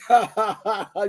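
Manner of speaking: real run of ha-ha laughter; rolling chuckle; knowing laugh. Real, not acted. Laughs at what happened afterwards.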